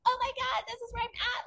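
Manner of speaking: very high-pitched hammed-up panic; mock yelling in a high squeal; mock freak-out. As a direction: imitate someone freaking out, voice way up high. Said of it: "yelling and screaming and being like".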